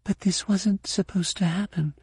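high-pitched, hushed protest; whispered. A narrator's reading. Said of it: "Mara whispered".

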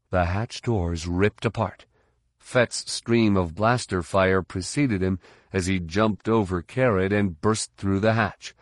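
ordinary delivery, nothing marked

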